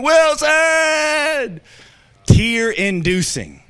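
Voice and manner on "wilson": high, loud anguished yell of a name; Tom Hanks yelling. Retold or imitated. Pastor imitating Tom Hanks losing Wilson, loud anguished yell. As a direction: call out across the sea in despair. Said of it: "Tom Hanks is saying".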